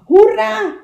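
high, loud cheer; happy cheer. Acted. Little beaver cheering with his friends, loud joyful cry. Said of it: "riep Kleine Bever".

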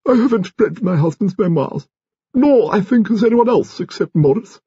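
very high, haughty grande dame; high-pitched aristocratic widow; imperious old lady. A narrator's reading. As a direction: deny it loftily in a high old lady's voice. Voices Lady Lacklander in story.

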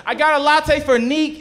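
high, loud, nervous call-out. Acted. Scared barista calling out a dreaded name, nervous. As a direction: call the order out high and uneasy.

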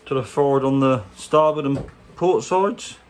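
ordinary talk

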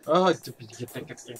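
stuttering, ending in a whisper; frightened stammer. Real, not acted. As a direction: caught off guard, stammer then whisper. Stammering when spotted in horror game.

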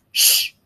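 long hushing sound; grunted shush. A narrator's reading. Big dinosaur trying to sleep, grunted shush. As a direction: shush the youngster. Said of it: "grunted the big dinosaur".

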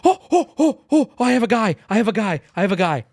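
starts high and loud, settles back toward normal; excited, calming. Real, not acted. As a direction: burst out with the find, then calm down. Excited at a new find.

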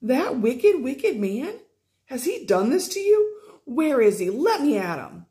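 ready to charge at the wizard; warlike outrage. Acted. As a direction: stepmother outraged, wanting to attack Howl. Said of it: "became so very warlike".

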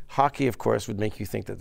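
ordinary talk

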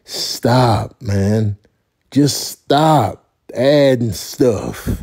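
ordinary talk, nothing marked

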